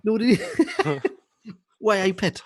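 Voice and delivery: high Geordie attempt, laughing; Geordie accent. Shown as a demonstration. Showing off an accent for co-host, Geordie. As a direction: put on a giggly, high-pitched Geordie. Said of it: "I wanna hear your Geordie accent".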